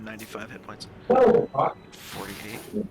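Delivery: muffled tinny voice, like a tube; talking into a soup can; through a tube. Real, not acted. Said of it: "why are you talking through a tube".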